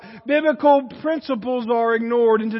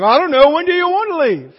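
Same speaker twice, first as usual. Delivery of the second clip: higher, louder evasive whine; mock evasive; 4 semitones higher, 6 dB louder, faster than usual. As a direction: toss the question back, playfully exasperating. Mock dodge of a question.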